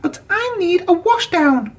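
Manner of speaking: high pleading wail. A narrator's reading. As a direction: whine for the washdown. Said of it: "wailed Percy".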